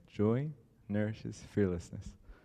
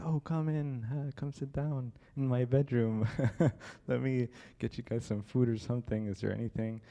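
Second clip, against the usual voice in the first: loud, higher-pitched mock hospitality; sarcastic welcome; 5 semitones higher, 6 dB louder than usual. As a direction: play the overeager host, sarcastically. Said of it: "he's extremely sarcastic".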